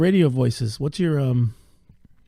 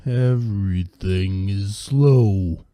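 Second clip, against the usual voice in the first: very slow drawn-out words; slow motion voice; 6 semitones lower, much slower than usual. Pretend. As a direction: drag each word out slowly. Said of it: "You don't have a slow motion voice?".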